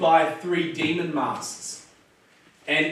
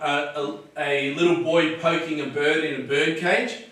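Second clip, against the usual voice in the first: ordinary delivery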